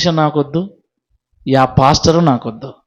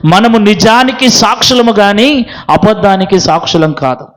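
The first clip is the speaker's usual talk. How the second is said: preaching exhortation; 6 semitones higher, 5 dB louder than usual. Real, not acted. Sermon exhortation to honesty.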